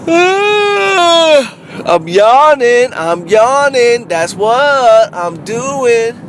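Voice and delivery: high, slow, drawn-out whining about yawning; mock high whiny yawn. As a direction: play a bored, whiny comic, stretched and high. Saget's bored voice, acted out.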